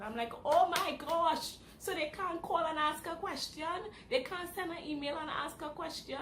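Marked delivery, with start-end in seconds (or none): 0.4-1.5 loud, slow, high Oh my gosh; exasperated shock. Retold or imitated. Hears card was locked.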